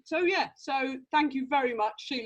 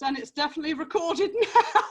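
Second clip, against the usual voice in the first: louder, higher, breaking into a laugh; 6 semitones higher, 6 dB louder than usual. Real, not acted. Host joking to the group after the performance, laughing. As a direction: say it brightly amused, laughing at the end.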